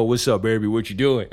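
lightly deepened smooth caller voice; deep male voice. Retold or imitated. Man greeting his date on the phone, lightly deep voice. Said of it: "like, a deep-ass voice go".

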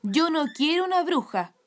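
cross mother, high and loud; mamá gruñona. A narrator's reading. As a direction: object loudly, grumbling at a daughter. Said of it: "gruñó mamá". (gruñido de madre enfadada, alto y fuerte)

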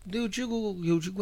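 ordinary delivery

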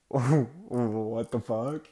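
drawn-out, stunned mock reaction; taken-aback friend. Classmate reacts to his sudden crying, taken aback. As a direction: draw it out slowly in disbelief.

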